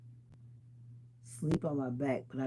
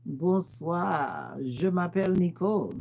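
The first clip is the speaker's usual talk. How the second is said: high school French; 4 semitones higher, 4 dB louder, slower than usual. Shown as a demonstration. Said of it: "testing my high school French".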